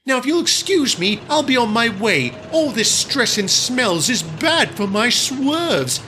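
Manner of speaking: high, disdainful, sniffy; haughty diesel lady. Acted. Daisy complains about the smelly shed, high and sniffy. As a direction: turn up your nose at the shed.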